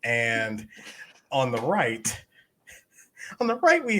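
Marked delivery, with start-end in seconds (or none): 3.4-4.0 high-pitched giggly announcement; laughing high announce. Shown as a demonstration. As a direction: break into laughter while announcing. Repeated, laughing and much higher.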